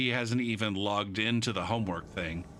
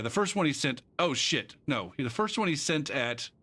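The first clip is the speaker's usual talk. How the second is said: ordinary delivery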